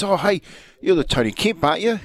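high, eager fan greeting; bright surprised hello from a stranger; retelling a fan spotting him at a cafe, eager; excited stranger. Retold or imitated.

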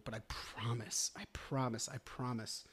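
soft, sincere repeated promise, fading; earnest reassurance. Real, not acted. Podcaster promising struggling listeners they'll make it, soft and earnest.

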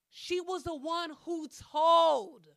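high, loud, slow outraged accusation; outraged realization. Retold or imitated. Spotting the cousin who told, loud high outrage. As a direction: spit it out like you caught the traitor.

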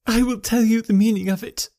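proud cry. A narrator's reading. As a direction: stand tall and answer him. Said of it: "cried the lady, ... proud, set face".

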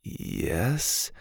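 soft, hushed yes; quietly. A narrator's reading. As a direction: answer under your breath. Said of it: "Dylan said quietly".

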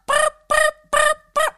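high, squeaky burp noises; vocal beat sounds. Retold or imitated. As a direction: chirp out the beat from the song. Imitating the song's beat.

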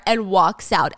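ordinary talk

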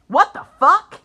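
loud sudden outburst; shocked. Real, not acted. Surprised by article.

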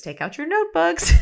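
bossy classroom teacher joke; mock teacher. Joking like a teacher.

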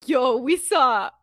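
loud, slow, drawn-out amazement; mock hype. Emmeline giving everybody a lame cover story, mock hype. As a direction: oversell it, stretching every word.

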